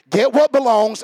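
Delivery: ordinary delivery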